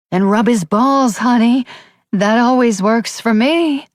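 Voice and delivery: high, loud, slow cruel mockery; the mother taunting her daughter; sneering mother. A narrator's reading.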